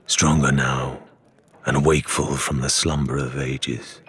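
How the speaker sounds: a waking monster in the deep; ominous sea beast. A narrator's reading. Ominous creature interlude narration.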